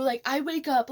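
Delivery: ordinary delivery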